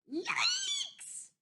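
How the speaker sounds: very high squawking shriek. Acted. Missus Chicken squawks in fright at the wolf, very high. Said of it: "squawked Missus Chicken".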